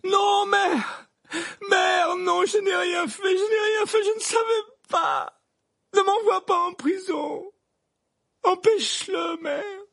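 hysterical high wailing plea; frantic, sobbing screams; screaming. Acted. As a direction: howl in terror for your mother. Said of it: "Le garçon se mit à hurler".

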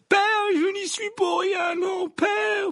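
very high, loud wail of protest; hysterical, drawn-out pleading; desperate screaming. Acted. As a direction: cry out for your father in panic. Condemned son screams for his father.

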